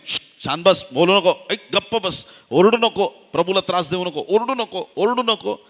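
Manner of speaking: high, loud, urgent shushing; acted agitated telling-off; scolding hush. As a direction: order a noisy beggar to be silent. Acted disciples hushing the blind beggar.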